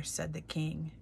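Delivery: ordinary delivery, nothing marked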